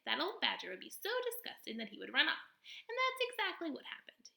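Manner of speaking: high, lively possum voice, theatrical; a showy little stage actor of a possum; possum character voice. Acted. Possum boasting to the robot, high and theatrical.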